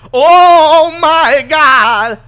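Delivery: drawn-out, high, loud cry of shock; shocked shout. Acted. Maxis shouts in horror as the zombies arrive, slow and loud. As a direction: stretch it out in a big shocked yell. Maxis reacts in scripted scene.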